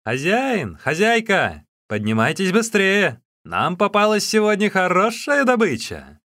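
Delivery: high, loud call to rouse the house; shouting. A narrator's reading. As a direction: shout excitedly for the innkeepers. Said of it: "крикнули кому-то".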